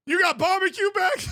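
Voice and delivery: high, loud excited announcer squeal; mock a hyped play-by-play call; Gus Johnson impression. Imitating Gus Johnson's wild call to friends. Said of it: "Gus Johnson's also vibes".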